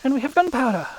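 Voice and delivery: high, loud burst of triumph; triumphant. Real, not acted. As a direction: announce the prize with a loud, excited lift. Celebrates getting the item.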